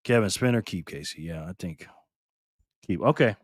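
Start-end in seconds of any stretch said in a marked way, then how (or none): none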